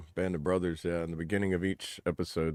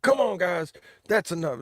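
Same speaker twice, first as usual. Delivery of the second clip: high, loud mock drill sergeant; 11 semitones higher, 6 dB louder than usual. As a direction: bark it like a weak drill sergeant. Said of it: "imagine him trying to act like a drill sergeant".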